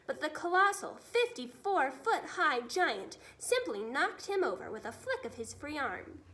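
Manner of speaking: ordinary delivery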